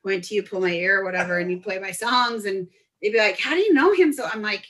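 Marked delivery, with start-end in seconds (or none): 3.4-4.1 high, mock-amazed question; fangirling. Bar-goers asking about her DJ friend, fangirling. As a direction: imitate a starstruck fan.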